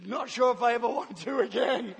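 ordinary talk

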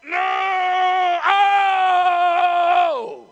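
long, high, loud mock wail; full-force imitated labour cry; desperate birthing scream. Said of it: "she's desperate".

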